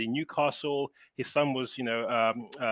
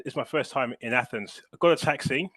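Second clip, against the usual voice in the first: ordinary delivery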